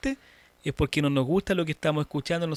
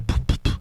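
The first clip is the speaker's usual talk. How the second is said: percussive "pu, pu, pu" plosives; imitated mic pops; 4 dB louder than usual. Shown as a demonstration. Teacher warning about speaking on top of mic, imitated pops. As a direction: pop the P sounds like an overloaded microphone.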